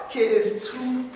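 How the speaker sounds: ordinary talk, nothing marked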